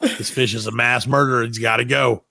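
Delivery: ordinary talk, nothing marked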